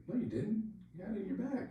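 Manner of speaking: high-pitched incredulous protest; imitated disbelief. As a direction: protest in mock bafflement, voice way up. Sergeant disbelieves the accusation.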